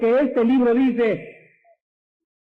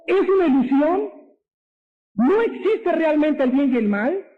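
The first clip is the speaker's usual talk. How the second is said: high-pitched, rising disbelief; incredulous challenge; 5 semitones higher than usual. Real, not acted. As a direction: challenge the listeners with each rising question. Rhetorical questions mocking the philosophy. (incredulidad indignada, en tono agudo)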